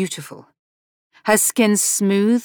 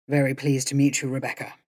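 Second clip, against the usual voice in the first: a lower, softer suave English gentleman; softly voiced Jeremy, lower pitch; 4 semitones lower, 6 dB quieter, faster than usual. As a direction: charm her, low and soft. Jeremy voiced low and soft.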